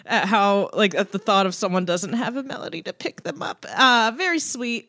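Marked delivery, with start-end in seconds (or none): none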